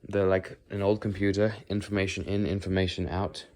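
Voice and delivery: ordinary talk, nothing marked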